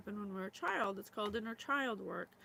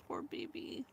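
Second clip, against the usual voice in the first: tender; 5 dB quieter than usual. Real, not acted. Hearing of childhood in war.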